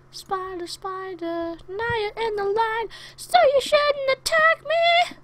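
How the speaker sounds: high, drawn-out voice rising to a loud squeal; high silly voice. Pretend. Mock-talking to an attacking spider.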